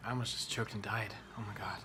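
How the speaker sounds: breathless whisper after choking; whisper, still recovering; hoarse whisper. Real, not acted. Just choked on her burger, whispering hoarsely.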